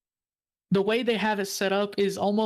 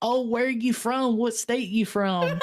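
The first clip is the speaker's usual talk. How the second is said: higher-pitched imitation of a Southern owner; Southern accent; 4 semitones higher than usual. Owner asks visitors where they're from, in a Southern accent. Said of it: "He talked like this".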